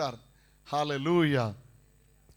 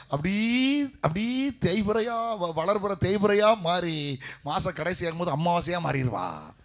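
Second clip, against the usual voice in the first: drawn-out comic telling; 5 dB louder, slower than usual. Acted. Comic moon-phase wife joke.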